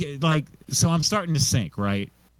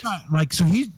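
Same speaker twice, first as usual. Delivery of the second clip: usual speaking voice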